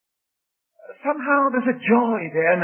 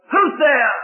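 rasping voice; 6 semitones higher, 5 dB louder than usual. Acted. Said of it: "It's a rasping voice".

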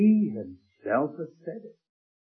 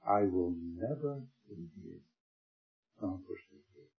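hushed tender promise; 5 semitones lower, 8 dB quieter, slower than usual. Acted. Soft quoted promise.

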